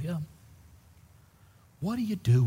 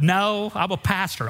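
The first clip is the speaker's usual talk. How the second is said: higher retold denial, stretched opening word; mock protest; 7 semitones higher than usual. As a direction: set the stranger straight in a raised voice. Acting his answer to stranger.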